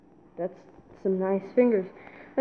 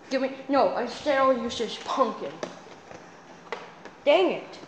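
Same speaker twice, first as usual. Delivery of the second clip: ordinary talk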